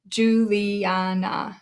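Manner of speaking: "Juliana" slowly split as Ju-li-a-na; syllable by syllable. Shown as a demonstration. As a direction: stretch out all four syllables of "Juliana". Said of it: "So we have four syllables there".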